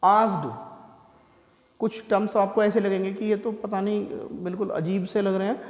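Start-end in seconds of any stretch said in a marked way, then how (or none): none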